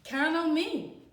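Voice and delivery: lightly voiced father, promising firmly; father's promise. Father reassures daughter in story.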